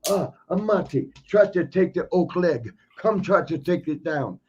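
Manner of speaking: put-on wrestler's taunting voice; imitating Sheik. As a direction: goad him to try a takedown. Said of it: "he'd always stick his leg out".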